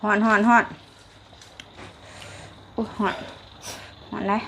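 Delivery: talking around a hot mouthful; blowing on scalding food in the mouth; mouth full of hot food. Real, not acted.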